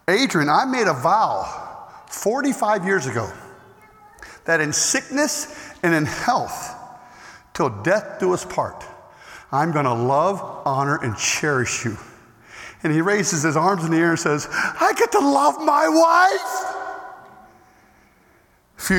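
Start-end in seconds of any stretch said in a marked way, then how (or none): 0.1-12.0 slow, soft and lower, reciting the vow; solemn vow. Retold or imitated. Old husband reciting his wedding vow to his friend, slow and hushed. 14.5-16.7 joyful declaration. Retold or imitated. Husband declaring his joy in loving his wife, slow exultant cry.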